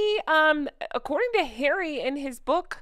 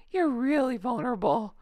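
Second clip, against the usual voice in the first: ordinary delivery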